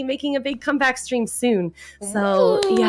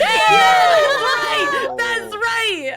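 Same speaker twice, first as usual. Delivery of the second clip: high, loud victory cry; triumphant cheer; 14 semitones higher, 5 dB louder, much slower than usual. Real, not acted. Rejected contestant celebrating she is still a winner. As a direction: crow it like a winner.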